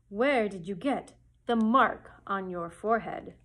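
slow, loud wizard head demand; booming Oz. Acted. The great head questions Dorothy, slow and booming. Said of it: "continued the voice".

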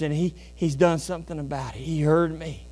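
normal talking voice

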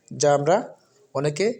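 usual speaking voice

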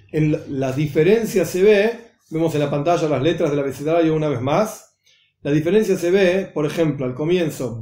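usual speaking voice

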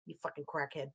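contemptuous undertone; muttered insult. Real, not acted. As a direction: spit it under breath. Venting insult.

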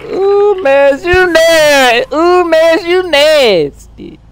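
mock sing-song. Real, not acted. Mocking opponent's move.

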